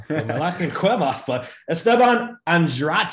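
slow, Italian-sounding name, Esteban Andrade in a light Italian accent. Real, not acted. Host introducing guest, saying his name with a light Italian accent. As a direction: draw out the name with an Italian lilt. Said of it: "You said it in Italian accent".

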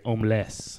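homeless in a fake French accent; hammed-up French accent. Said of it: "as the French would say".

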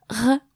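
throaty French R repeated; R from the back of the throat; French uvular R again. Shown as a demonstration. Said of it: "pronounced R".

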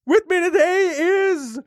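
high, drawn-out ghostly announcement; spooky ghost host introducing a guest; wailing ghost. Pretend. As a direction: stretch the name into a long wail.

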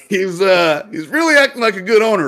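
mock-praise him to his partner; retold sarcasm. Bartender to the other owner, sarcastic complaint. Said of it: "and I'm like".

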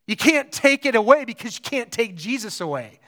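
normal talking voice

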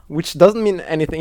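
usual speaking voice

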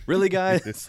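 exasperated "oh come on" reaction; mock disbelief. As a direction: call them out, half laughing.

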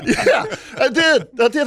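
high, slow, laughing yes; laughing admission. Real, not acted. Captain laughing as he admits killing the bird. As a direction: own it while cracking up.

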